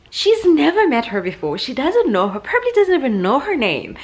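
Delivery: high, loud disbelief; outraged. Real, not acted. Mom venting about daughter opening stranger's fridge, high and loud.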